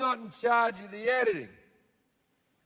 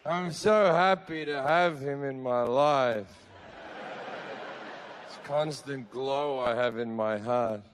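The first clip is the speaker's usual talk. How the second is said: dreamy devotion; 7 semitones lower than usual. Acted. Believers gush about God.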